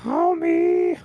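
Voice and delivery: very high strained Homie; Marge impression. Calling Homer as Marge, very high. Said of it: "That's Marge".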